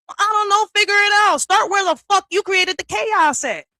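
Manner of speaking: high, loud angry yelling; angry shout. Real, not acted. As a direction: shout it at them, fed up. Ranting at people who blame her.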